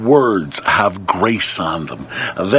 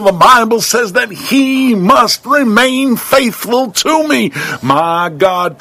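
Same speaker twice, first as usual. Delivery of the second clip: rising, much higher repeated sermon lines; preaching at full force; 6 semitones higher, 3 dB louder than usual. Acted. Preacher declares his faith to the congregation, building higher. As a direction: build the refrain until it soars.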